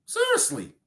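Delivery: "Seriously" jumps way up high in disbelief; incredulous. Real, not acted. Host to the panel: "Seriously" pitched far up in disbelief.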